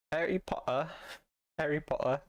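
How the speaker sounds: a light British try at "Harry Potter"; British accent. Shown as a demonstration. Streamer trying a British accent for chat. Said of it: "Say Harry in a British accent".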